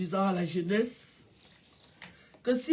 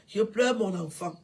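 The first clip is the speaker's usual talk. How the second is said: ordinary talk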